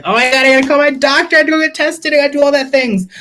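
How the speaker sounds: high, rushed freak-out on one held pitch; reenacting a frantic overreaction; retold panic. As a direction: gabble it in a high mock panic. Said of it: "I went crazy".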